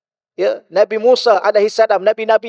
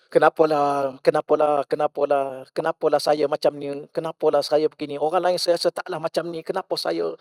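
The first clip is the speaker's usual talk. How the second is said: a softer, mildly whiny imitation of someone lamenting; a hint of a self-pitying complainer; complaining believer; 5 semitones lower, 5 dB quieter than usual. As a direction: lightly act out a self-pitying moaner.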